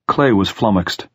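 ordinary delivery

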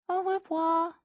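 put-on high, drawn-out little-girl goodbye; reluctant child's singsong farewell; meek small child. A narrator's reading. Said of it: "the four-year-old says meekly".

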